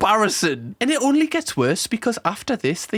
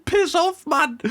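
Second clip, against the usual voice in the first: imitating the kid; 9 semitones higher, slower than usual. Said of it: "Why is the baby saying".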